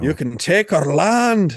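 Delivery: slow, high mock-Scottish war cry; hammed-up Scottish accent. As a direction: draw it out in a bad Scottish accent. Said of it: "attempt at a Scottish accent".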